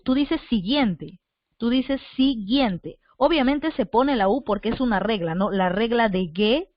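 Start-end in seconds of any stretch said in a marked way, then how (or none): 0.0-3.0 slow, careful "siguiente" with the U silent; slow model word. Shown as a demonstration. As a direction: spell it out by ear, "si-gien-te". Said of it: "tú no pronuncias la U". ("siguiente" lento y marcado, sin la U)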